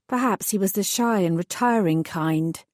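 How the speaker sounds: usual speaking voice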